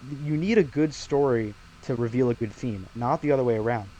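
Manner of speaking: usual speaking voice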